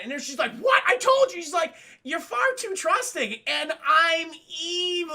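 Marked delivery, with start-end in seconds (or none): 0.6-1.4 high, loud shocked protest; hammed-up indignant disbelief; mock outrage. Leia protesting Tarkin's betrayal, mock outrage. 2.0-5.1 high, loud hammed-up villain; slow, drawn-out final boast; gloating villain. Tarkin gloating over Leia's trust, mock villain.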